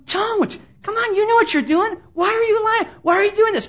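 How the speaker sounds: high-pitched, exasperated appeal; imitated insistent inner voice; urgent pleading. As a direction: plead with someone to stop lying. Spirit pleading with Ananias, acted.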